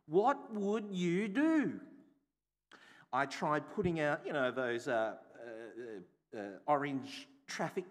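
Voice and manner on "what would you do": slow, every word weighted; slow emphatic question. Shown as a demonstration. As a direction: let the question hang over the room.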